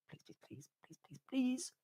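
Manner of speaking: barely whispered, then squeaking up high; hushed pleading. Pretend. Fan praying for a fighter to lose, hushed pleading. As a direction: beg under the breath, rising to a tiny high plea. Wishes hard for the loss.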